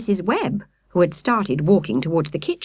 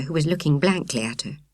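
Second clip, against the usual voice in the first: plain reading voice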